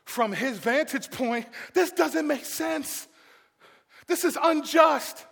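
high, forceful declaration of injustice; indignant protest. Acted. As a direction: protest it in pained disbelief. Man's view set against God's.

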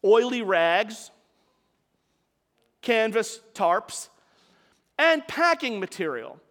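slow, each item weighed out; slow list. Shown as a demonstration. Preacher opening the story, slow deliberate listing. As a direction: lay out the fuel one item at a time.

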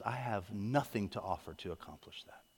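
hushed, dropping away at the end; humbled admission. Acted. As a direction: voice someone quietly admitting helplessness. Voices someone admitting they are helpless.

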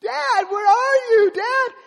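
put-on high, loud, slow wailing little boy; lost child sobbing in a corn maze; crying child. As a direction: wail for dad, lost and scared. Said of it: "start hearing him crying".

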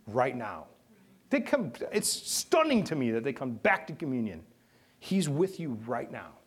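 normal talking voice

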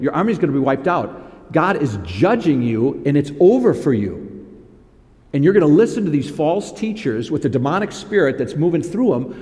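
ordinary talk

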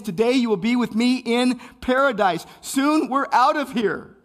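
loud, high, impassioned sermon voice; fiery pulpit preaching with long dramatic pauses; preaching at full force. Acted. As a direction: preach it out to the whole room, building in fervour. Preaching Jesus' promise of paradise.

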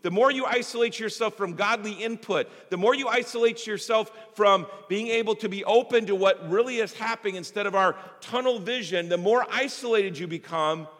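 rising, emphatic preacher's build; preaching. Acted.

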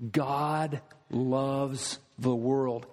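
"God loves the world" drawn out slowly, "God" stressed; slow emphasis. Real, not acted. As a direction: lay it down slowly and firmly. Sermon emphasis rebutting error.

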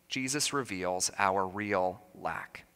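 quiet, slow echo of the point; soft restatement. Shown as a demonstration. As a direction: drop to a hushed repeat, stressing "Jesus". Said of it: "restating the point more softly".

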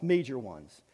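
ordinary talk, nothing marked

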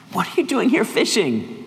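high-pitched, incredulous question; acted exasperated reproach; mild chiding. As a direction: gently scold a wayward friend, pitch lifted high. Imagined Jesus chiding Peter for fishing.

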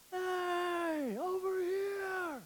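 high, slow distant call; drawn-out high hailing shout; God calling out. Acted. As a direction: call out from far away like God beckoning. God calling the lost people.

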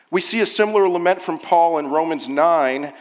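ordinary talk, nothing marked

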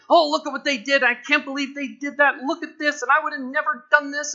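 mocking, loud high voice of a fault-finder; judgmental critic. Preacher mocking a judgmental churchgoer, loud sneering. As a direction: sneer at them like a gossiping critic. Self-righteous critic judging others.

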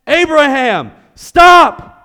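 high, loud call of stop; urgent shout. Acted. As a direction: cry out to halt the knife. Angel's sudden call in story.

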